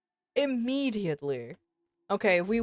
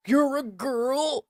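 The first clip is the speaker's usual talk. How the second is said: slow, stunned, higher disbelief; his stunned question; 5 semitones higher, much slower than usual. Retold or imitated. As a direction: drag it out like he can't believe it. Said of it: "That's how he sounded".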